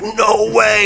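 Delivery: high-pitched mock disbelief; retold amazement. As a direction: react like an astonished viewer. Voices amazed viewer spotting a star.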